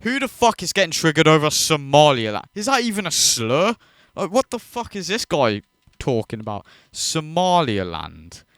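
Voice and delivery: ordinary talk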